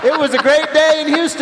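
high, loud hammed-up exultation; mock elation. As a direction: crow it like a mock-triumphant mayor. Host voices the mayor exulting.

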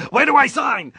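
loud, high-pitched burst of eagerness; comically overeager shout; eager yell. Retold or imitated. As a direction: jump at the offer with an excited shout. Eager answer to job offer.

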